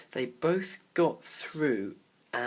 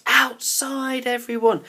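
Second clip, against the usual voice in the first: higher, louder call to everyone; 12 semitones higher, 10 dB louder than usual. A narrator's reading. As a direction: call out to the whole house. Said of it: "calling out".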